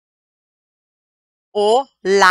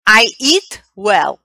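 I eat well, slow and a little louder; slow example sentence; 2 semitones higher, 5 dB louder, slower than usual. Shown as a demonstration. Teacher slowly models an adverb sentence. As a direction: give it slowly and clearly. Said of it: "a verb".

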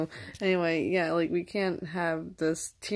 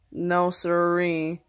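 slow drawn-out; much slower than usual. Acted. Drawn-out folksy refusal.

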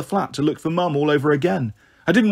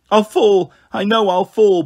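put-on frightened child; 4 semitones higher, 5 dB louder, slower than usual. A narrator's reading. Girl terrified of falling.

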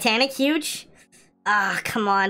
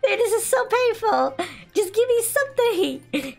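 high, whiny, pleading fake sob; mock crying; 10 semitones higher than usual. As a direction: beg desperately in a high, hammed-up wail. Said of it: "I'm crying".

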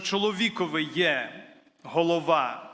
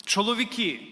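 ordinary talk

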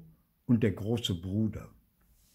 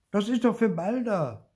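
put-on higher, louder insisting child; 13 semitones higher, 7 dB louder than usual. A narrator's reading. Theo insisting the roll is for Balder, high and firm. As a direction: stand your ground as the boy. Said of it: "beharrt Theo".

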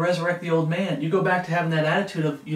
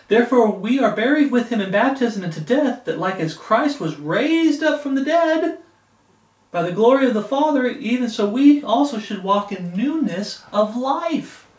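louder, higher, slowed proclamation; fervent scripture reading; 4 semitones higher, 4 dB louder than usual. Acted. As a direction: proclaim the verse to the church.

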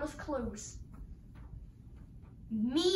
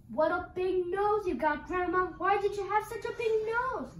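astonished questioning; 5 semitones higher than usual. Acted. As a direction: climb higher as you question grandma. Red questioning disguised wolf.

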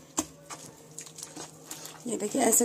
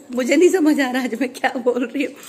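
giggling through the words at her own muddle; laughing at herself; 4 dB louder than usual. Real, not acted. Vlogger laughing at her own slip to viewers. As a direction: laugh it off, embarrassed but amused.

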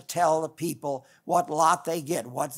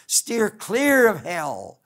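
slow, raised, louder warning; emphatic warning; 4 semitones higher, 6 dB louder, much slower than usual. Retold or imitated. Preacher telling people what Jesus warns, slow emphatic warning. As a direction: draw it out, loud and higher, as a warning. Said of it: "he wants to warn people".

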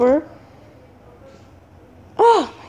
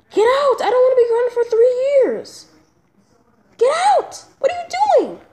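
frantic, pitched way up; panicked high yelling; 6 semitones higher than usual. Acted. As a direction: shoo him out in a panic. Panicked kid shouting at friend.

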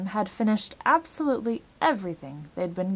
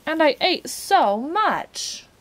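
higher, slow, drawn-out complaint; complaining; 8 semitones higher, 6 dB louder, much slower than usual. A narrator's reading. Milo complaining of his empty stomach, slow and plaintive. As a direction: wail it slowly in dismay. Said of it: "complained Milo".

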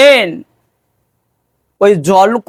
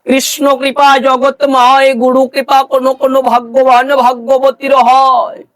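high, sustained devotional intoning; preaching; 5 semitones higher than usual. Acted. Guru teaching of Radha Rani's grace, intoned preaching. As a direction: proclaim it to the devotees in a raised chant-like voice.

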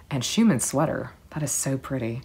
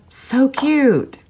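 high, loud squeal of delight; gushing; 12 semitones higher, 7 dB louder than usual. Real, not acted. Fan reacting to a photo, squealing high and loud. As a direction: gush like a fan seeing a favourite photo.

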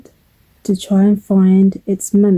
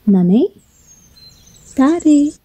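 put-on small questioning baby-bird call; little bird chirping for its parents; 7 semitones higher than usual. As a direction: call out for missing parents, voice lifting. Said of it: "chirped the little bird".

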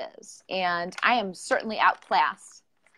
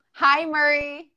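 cheerful greeting; 7 semitones higher, 6 dB louder, much slower than usual. Real, not acted. Greeting guest joining live stream.

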